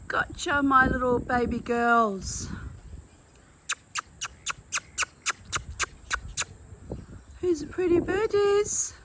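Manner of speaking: put-on high sing-song baby talk. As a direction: coo to the birds like babies. Cooing to parrots.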